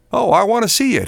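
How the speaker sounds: put-on high, eager child's exclamation; exclaiming. A narrator's reading. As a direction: lift it high like a keen son. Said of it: "he exclaimed".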